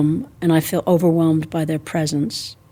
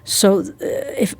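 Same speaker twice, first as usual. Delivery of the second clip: ordinary talk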